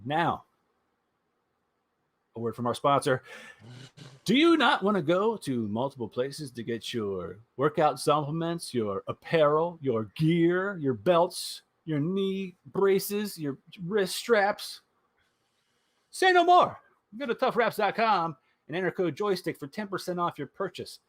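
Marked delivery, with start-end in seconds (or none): 4.2-14.8 upbeat pitchman voice, higher, pushing; sponsor ad read. Acted. Host reading sponsor spot for listeners, hyped ad read. 16.1-16.8 much higher and louder exclamation; hyped pitch. Acted. Host pitching sponsor, loud high punchline.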